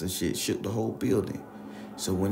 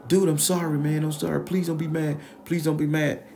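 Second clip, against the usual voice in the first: pleading high voice; 8 semitones higher than usual. Retold or imitated. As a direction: plead like a dazed guy. Injured Ralph apologizing frantically.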